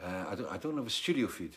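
quiet, measured, keeping cool on air; calm and composed. Retold or imitated. Live TV guest calmly tells studio there's no feed, composed. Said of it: "look calm and composed and go".

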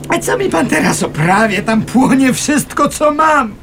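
everything you own is burning; panicked, desperate arguing; distraught protest. Acted. Arguing at the fire.

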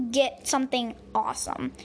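ordinary delivery

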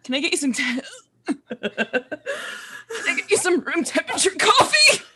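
gagging through laughter as a nauseated waiter; fake dry heaving. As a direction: retch between the words, laughing. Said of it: "us pretend dry heave".

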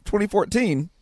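ordinary delivery

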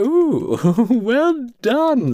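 high cooing congratulations with a giggle; mock-impressed, high-voiced well-wisher; impressed admirer. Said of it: "And like".

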